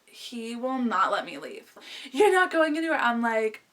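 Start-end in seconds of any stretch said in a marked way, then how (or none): 2.1-3.0 higher, louder mock order; imitated demanding boyfriend. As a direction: mimic him forbidding it, pitched up. Boyfriend forbids her leaving.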